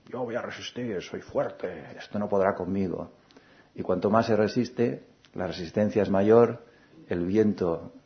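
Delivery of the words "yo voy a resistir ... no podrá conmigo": a proud tree, stubborn but softer, lightly voiced; defiant tree. A narrator's reading. The tree talks to the hurricane, lightly voiced defiant pride. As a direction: gently play the stubborn tree bracing against the hurricane. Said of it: "con todo su orgullo".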